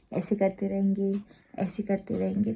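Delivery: soft, hushed lulling voice; gentle cooing as if patting someone to sleep; soothing murmur. Retold or imitated. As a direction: murmur it like rocking a child to sleep. Showing the sister's soothing pats.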